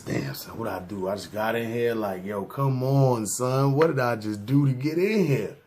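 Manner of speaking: low, hushed self-questioning; subdued acted disbelief at being locked up; dejected muttering to oneself. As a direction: mutter it low, rising gradually into complaint. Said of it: "You sitting there like this".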